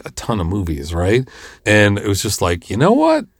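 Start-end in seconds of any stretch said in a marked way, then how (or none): 2.7-3.3 high, exasperated "you know what"; fed-up retort. Retold or imitated. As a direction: throw up your hands, pitched high. Retelling his snap at actor.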